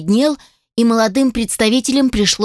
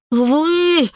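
a higher, seething female Вы; growled; 7 semitones higher than usual. Acted. Furious elf assistant growls at the inventor, angry. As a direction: spit it out in fury. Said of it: "прорычала она".

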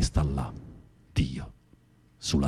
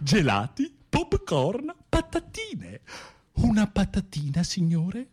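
very high falsetto vendor call; squeaky usher selling ice cream and chips; cinema snack vendor; 13 semitones higher than usual. Acted. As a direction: hawk the snacks in a piping high voice.

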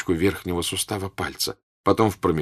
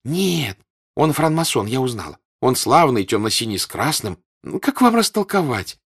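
high, loud, pressing; eager girl; 6 semitones higher, 5 dB louder than usual. Acted. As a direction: daughter begging her mother to understand. Girl keeps explaining.

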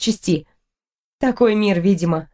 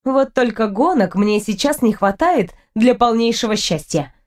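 ordinary delivery

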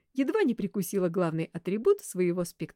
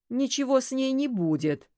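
dismissive grumble, raised and louder; grumbling; 6 semitones higher, 5 dB louder than usual. A narrator's reading. Detective dismissing worry about the girl in a faint, grumbling. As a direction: grouse it, brushing off the concern. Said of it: "ворчливо сказал Арсений".

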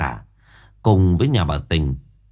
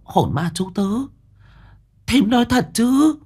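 much higher, rising, shocked disbelief; stammering shock. Acted. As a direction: blurt it out, stunned. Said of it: "lắp bắp".

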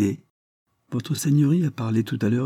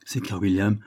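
ordinary delivery

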